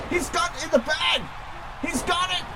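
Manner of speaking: high shouted call; shouting commentator. Acted. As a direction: yell out the win.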